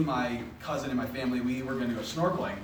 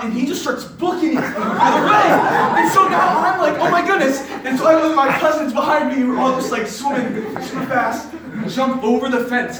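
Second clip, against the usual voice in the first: loud, high-pitched comic panic; hammed-up alarm, easing slightly toward the end; retold frantic escape; 11 semitones higher, 12 dB louder than usual. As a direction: shout the chase story over the laughs.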